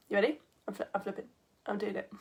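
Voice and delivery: very soft, halting countdown; hushed warning. Real, not acted. Teacher about to flip the camera for viewers, hushed warning. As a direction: murmur it low, as if to yourself.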